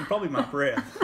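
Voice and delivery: laughing through the words; giggling while talking. Real, not acted. Laughing at own bad breath.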